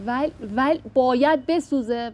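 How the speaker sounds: ordinary talk, nothing marked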